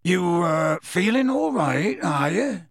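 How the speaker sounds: hesitant, drawn-out question rising high; rustic voice gingerly checking on a friend; tentative concern. A narrator's reading. Gamekeeper asking after grieving boy, tentatively.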